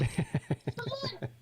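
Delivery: squeaky high burst of laughter. Real, not acted. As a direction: let out a high surprised laugh. Laughs at coach joke.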